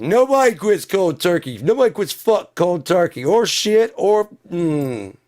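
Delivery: high, yelled protest; loud, worked-up tirade; furious rant. Acted. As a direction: yell it in outraged disbelief. Ranting that nobody quits cold turkey.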